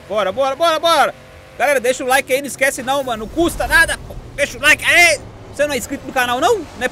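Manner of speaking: loud, high hype shout to the chat; hyped call to viewers. Real, not acted. Streamer hyping his viewers to leave a like, loud and high. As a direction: pump up the viewers, almost yelling.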